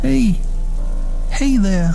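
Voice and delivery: voice cracking. A narrator's reading. Harriet on her knees calling to her dog Hefty, voice cracking. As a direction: let the voice break as you call the dog. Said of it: "my voice cracking".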